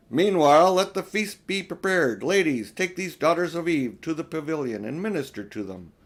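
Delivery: loud, regal command; commanding lion. A narrator's reading. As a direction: order the feast like a lion king. Aslan commanding the feast.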